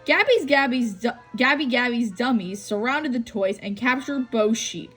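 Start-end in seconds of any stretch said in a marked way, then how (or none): none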